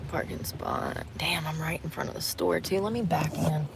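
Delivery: whispered muttering while parking; soft whispered aside. Real, not acted. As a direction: keep it under your breath.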